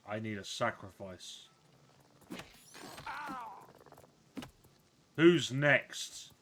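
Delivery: loud, booming mock-grand wizard proclamation; hammed-up self-proclaimed crab wizard; pompous wizard. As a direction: lord it over the crab minions. Wizard scolds enemy.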